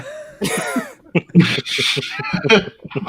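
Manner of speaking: loud, high, airless wheeze of a laugh; breathy laugh. Real, not acted. Laughing at the Alfred joke.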